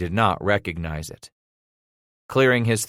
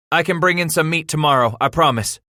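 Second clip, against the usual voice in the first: put-on high boy's voice; earnest pleading; 7 semitones higher, 4 dB louder than usual. A narrator's reading. Son promising father meat, pleading. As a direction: offer the promise anxiously.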